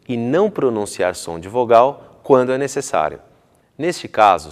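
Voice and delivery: ordinary talk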